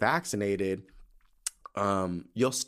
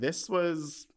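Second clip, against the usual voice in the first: ordinary talk, nothing marked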